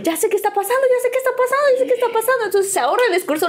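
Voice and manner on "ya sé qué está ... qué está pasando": high, repetitive panicked realization; mock panic. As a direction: act out panic rising as it dawns on you. Acted dawning panic at proposal.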